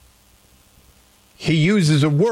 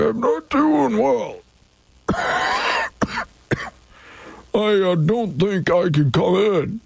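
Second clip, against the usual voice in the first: pitched-up hammy sick caller; fake sick voice; 7 semitones higher than usual. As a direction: ham up being too sick to work. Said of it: "Here's my sick voice".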